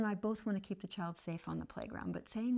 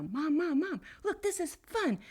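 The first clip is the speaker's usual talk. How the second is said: put-on much higher, excited little boy's call; child calling mom; 9 semitones higher, 4 dB louder, slower than usual. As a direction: show off to mom from the top. Boy calls from the railing.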